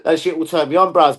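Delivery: normal talking voice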